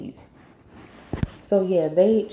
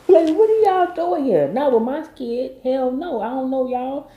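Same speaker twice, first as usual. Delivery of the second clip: high-pitched, affronted challenge; mock outrage, pitched well up; indignant confrontation; 8 semitones higher, 4 dB louder than usual. Darla confronting strangers around her kid, acted out indignantly.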